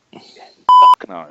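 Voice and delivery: very high, loud, explosive yell; furious outburst at the casting news; outraged shout. Real, not acted. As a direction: blurt out a horrified protest. Outraged at [name] casting.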